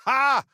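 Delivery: sharp, high, loud bark of victory; triumphant ha. Acted. Gloating at beaten enemies, high triumphant laugh. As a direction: crow over the win.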